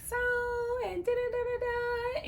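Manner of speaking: high mocking baby talk. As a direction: imitate a cutesy babyish coo. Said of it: "she does that baby voice".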